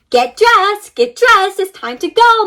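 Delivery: high, loud mom's call through a door. A narrator's reading. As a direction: call him out of bed like mom. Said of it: "Mom said through his bedroom door".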